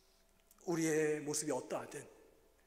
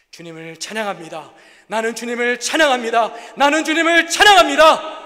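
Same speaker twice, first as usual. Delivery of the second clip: full-throated cry, much higher and louder; shouted praise; 8 semitones higher, 26 dB louder than usual. Retold or imitated. Pastor bursting into praise after God answers, shouting. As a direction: build to a crying shout of praise.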